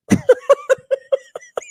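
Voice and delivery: high, quick giggle; a rapid string of light chuckles. Real, not acted. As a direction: laugh at your own story. Laughs at the axe anecdote.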